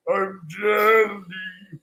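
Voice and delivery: very slow, drugged-out drawl; imitating someone on downers. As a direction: stretch every sound as if heavily sedated. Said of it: "he's loaded on down".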